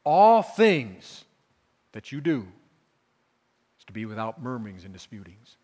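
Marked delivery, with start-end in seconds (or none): 0.0-1.2 ALL hit loud and high; stress on ALL. Shown as a demonstration. Preacher hammering All to the congregation. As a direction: punch the word All. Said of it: "He's talking about all things".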